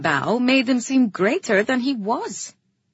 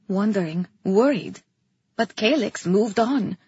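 usual speaking voice

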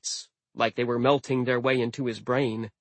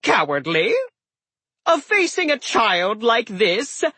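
scoffing; 10 semitones higher, 4 dB louder than usual. Acted. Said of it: "Loki laughed".

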